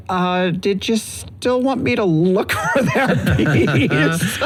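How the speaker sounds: hesitant, drawn-out question breaking into a laugh; tentatively interrupt, slowing down and giggling; awkward retold question. Peeking out at the arguing couple, awkward.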